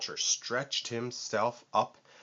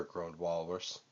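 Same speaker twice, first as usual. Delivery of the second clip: usual speaking voice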